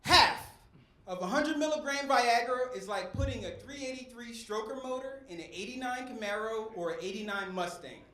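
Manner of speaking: ordinary delivery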